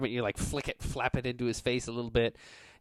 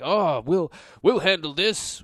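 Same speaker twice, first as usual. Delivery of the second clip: higher, louder officious guard voice; smug goon; 7 semitones higher, 9 dB louder, slower than usual. Acted. Kingfisher's goons stepping forward, loud and smug. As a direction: step in like a cocky thug. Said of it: "they're like".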